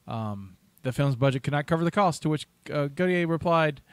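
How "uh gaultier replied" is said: normal talking voice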